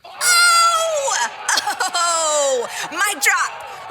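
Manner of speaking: high, loud victory whoop; loud, high celebratory shout; triumphant whooping. Real, not acted. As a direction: crow it like a winner. Celebrating her own bold line.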